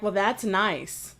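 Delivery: slow, soft fake calm; mock calm. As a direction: imitate calm, quietly rude politeness. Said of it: "they say it in a calm tone".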